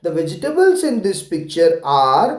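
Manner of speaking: very slow, every word spaced; slow model reading. Shown as a demonstration. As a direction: read it slowly for them to repeat. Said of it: "मैं पढ़ता हूँ, आप ऐसे बोलना".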